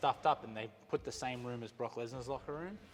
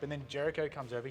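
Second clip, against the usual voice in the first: usual speaking voice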